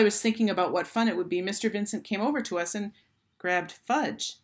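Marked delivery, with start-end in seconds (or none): none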